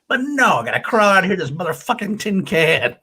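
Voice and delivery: a hammed-up fed-up hero; McClane complaint. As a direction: quote the line as a fed-up action hero. McClane line quoted, acted.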